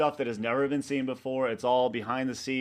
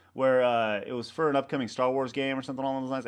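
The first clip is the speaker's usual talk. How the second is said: normal talking voice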